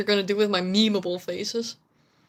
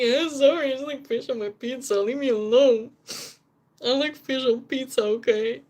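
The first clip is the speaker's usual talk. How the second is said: slightly raised, whiny self-defence; mock defensive; 3 semitones higher than usual. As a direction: act out a hammed-up protest to friends. Retold defence against complaining friends.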